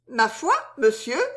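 high, teasing soubrette; amused, laughing. A narrator's reading. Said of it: "dit Suzon en riant". (amusée, rieuse)

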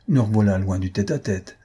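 plain reading voice

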